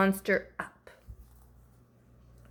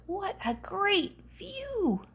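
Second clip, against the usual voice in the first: slow, high hushed whisper; a slow, wondering whisper; 11 semitones higher, 6 dB quieter, slower than usual. Acted. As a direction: breathe it in awe. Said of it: "This guy up here says".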